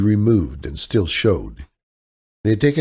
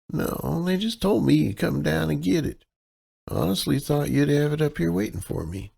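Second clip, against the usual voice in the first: higher, helpless Midwestern damsel voice; damsel in distress; 8 semitones higher, 5 dB quieter than usual. Acted. Kaylee sweet-talks the clerk as a damsel in distress. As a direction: play the sweet, lost out-of-towner, pitched up. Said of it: "her damsel in distress persona".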